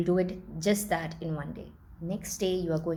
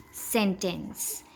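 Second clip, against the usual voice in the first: ordinary talk, nothing marked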